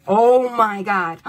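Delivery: slow, loud oh my God, then a high gasp; stunned by the cards; real astonished gasp. Real, not acted. Reacting to cards.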